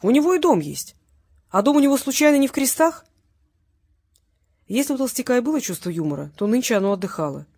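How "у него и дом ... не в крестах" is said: higher, louder teasing wonder; amused, raised-voice astonishment; mock surprise. A narrator's reading. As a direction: act amazed to needle the captive. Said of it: "удивился Валерий".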